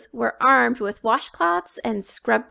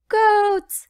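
very high squeaky monster; a small excited creature; high loud goats; tiny monster; 11 semitones higher, 6 dB louder than usual. A narrator's reading. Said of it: "said the tiny monster".

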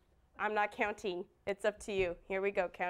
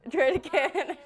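louder, laughing through the words; 4 dB louder than usual. Real, not acted. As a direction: call for a retry while cracking up. Laughs as the clapping goes wrong.

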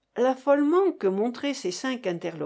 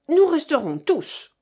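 loud, high firm vow; loud declaration; 7 semitones higher, 8 dB louder than usual. A narrator's reading. Valenay backs the mother, loud firm vow. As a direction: plant your feet and declare it.

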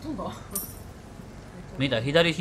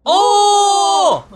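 a long, high, loud "おお" of real amazement; amazed shout; 10 dB louder than usual. Real, not acted. Gamer sees the hair colour change, shouting in amazement. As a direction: let the surprise burst out full voice.

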